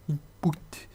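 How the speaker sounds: INPUT in a hammed-up English accent; mock English accent. Shown as a demonstration. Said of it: "Magnifique mon accent anglais". (accent anglais exagéré, pour rire)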